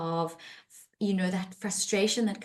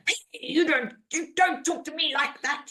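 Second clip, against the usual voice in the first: higher mock tough retort; defensive anger; 7 semitones higher than usual. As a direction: snap back, hammed-up defensive. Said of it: "what I show is anger".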